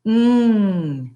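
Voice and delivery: Mm held as a nasal ng sound at the back of the mouth. Shown as a demonstration. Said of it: "N and G together".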